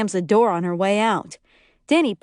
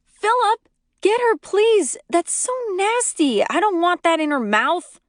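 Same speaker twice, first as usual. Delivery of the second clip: disgusted shout; 10 semitones higher than usual. A narrator's reading. Narrator yelling at Philip to grab the dog. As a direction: yell it, grossed out.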